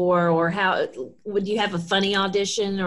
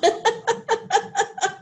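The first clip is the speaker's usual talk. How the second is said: laugh; 14 semitones higher than usual. Real, not acted. Host laughs.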